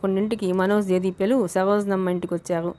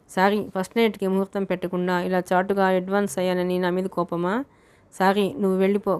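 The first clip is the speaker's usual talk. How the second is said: plain reading voice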